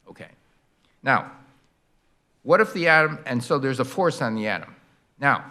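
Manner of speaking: ordinary talk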